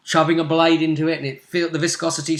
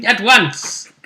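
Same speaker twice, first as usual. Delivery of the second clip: loud, high, imperious finish; hammed-up decree through laughter; playful barked order; mock-grand command; 7 semitones higher, 7 dB louder than usual.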